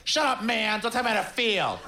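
high, mock-furious snarl; hammed-up rage; angry blogger. Blogger snapping at a cheerful friend, high mock fury.

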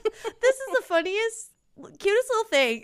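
put-on aunt swooning over her toddler nephew, high and gushing. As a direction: melt over it out loud.

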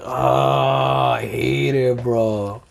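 drawn-out, weary mock groan; groaning dread. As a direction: groan it out low, hating every rep. Acting out dread of doing push-ups.

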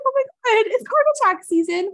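high, loud mock panic. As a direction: squeal it like a joke panic. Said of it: "was like".

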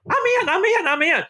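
high excited I'm in; eager yes. Retold or imitated. Manager accepting a show invitation, high excited I'm in. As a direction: jump at the offer, eager and high. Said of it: "and I went".

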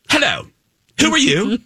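high, haughty character greeting; sassy Cruella de Vil. Retold or imitated. Villainess greets two running fans, sassy. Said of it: "she was so sassy".

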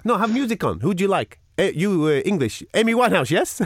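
high, halting foreign host imitation; broken English. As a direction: mimic eager broken English. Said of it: "a conversation in broken English".

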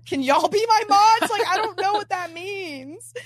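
high, loud, clueless appeal; helpless plea. Retold or imitated. Sarah asking girlies to be her mods, helplessly clueless.

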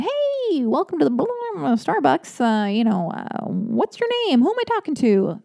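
high, bright sing-song barista greeting; cheerful barista. Retold or imitated. Starbucks barista cheerfully greeting a drive-thru customer, high sing-song. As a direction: chirp it like an overly cheerful barista. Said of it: "The person was being really sweet and cheerful".

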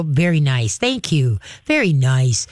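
low, calm, settled praise. Shown as a demonstration. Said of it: "very calmly with your voice go".

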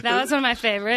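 ordinary delivery

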